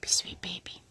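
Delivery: soft whispered coo; whispering. Real, not acted. Mother whispers to the baby.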